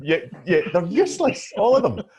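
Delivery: high, loud laughing rant. Real, not acted. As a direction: laugh through the words. Laughing rant about useless power.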